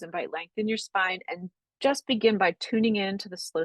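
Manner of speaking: calm, unhurried breathwork guidance; guided meditation. Acted. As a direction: ease the class gently into noticing the breath. Leads a guided breathing practice.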